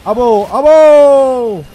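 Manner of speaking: long drawn-out shocked cry; stretched alarm. Real, not acted. Gamer caught under fire, crying out slowly in alarm. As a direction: drag out the alarm loudly.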